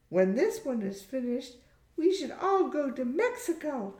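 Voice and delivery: put-on high-pitched young woman's voice; high, girlish friend's suggestion; Sarah, cheerful friend. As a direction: pitch it high as you propose a group trip.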